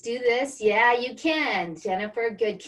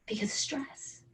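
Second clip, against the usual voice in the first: whispered; 10 dB quieter than usual. Retold or imitated. Confession of dying inside.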